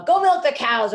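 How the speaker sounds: loud, high father's command; stern shouted chore order; barked order. Retold or imitated. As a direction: snap the chore at the boy. Father's actor ordering kid in character.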